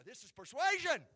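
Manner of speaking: loud, high burst; emphatic preaching shout. Real, not acted. Preacher naming Rahab's persuasion, emphatic shout. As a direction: cry it out to the congregation. Preacher drives home his point.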